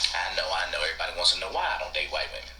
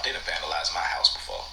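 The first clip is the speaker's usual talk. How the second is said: ordinary delivery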